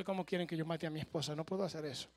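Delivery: voz quebrada, más suave, rápida; quejido lloroso. Acted. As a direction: suplica casi llorando. Segundo hombre llora, no puede. (voz llorosa de hombre que no puede matar)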